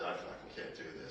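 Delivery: soft whisper with a laugh; laughing whispered admission; whispered laughing confession. Retold or imitated. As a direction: hush it out, giggling at yourself. Confessing quietly to colleague.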